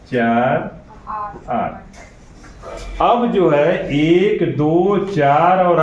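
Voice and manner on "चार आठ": low, slow count with long gaps. Real, not acted. Teacher listing common factors for the class, slow counting out. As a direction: count out each number slowly.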